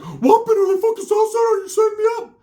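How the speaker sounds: shrieked way up, alarmed; panicked high yell. Retold or imitated. Ricky fearing a setup, high panicked yell. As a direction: jump up and accuse at the top of your voice.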